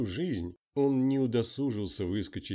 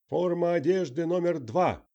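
shouting drill sergeant; 9 semitones higher, 6 dB louder than usual. Acted. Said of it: "которую объявил Дневальный".

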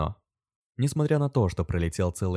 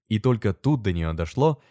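usual speaking voice

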